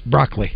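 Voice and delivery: BROCCOLI squeezed to BROCK-lee; two syllables. Shown as a demonstration. Co-host answering how he pronounces it, two syllables. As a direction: drop the middle vowel of BROCCOLI: BROCK-lee. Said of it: "two syllables".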